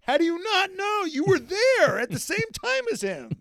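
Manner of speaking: high, loud incredulous shout; can't believe it, voice up high; exasperated disbelief. Real, not acted. Host ranting at Daniel, high, loud disbelief.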